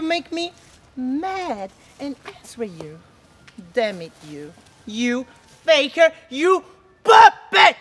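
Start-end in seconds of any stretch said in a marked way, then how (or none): 4.9-7.8 loud, high, slow shout; loud slow shouted insult. Acted. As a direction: hurl the insult at her. Helena insulting Hermia.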